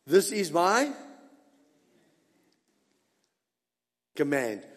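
emphatic, with a long hold before COMMAND. Retold or imitated. Preacher quoting God to the congregation, emphatic command. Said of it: "He's being emphatic".

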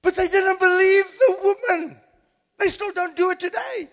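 high, loud, laughing revival preaching; fired-up Easter sermon; preaching at full force. Acted. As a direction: bring the congregation to its feet.

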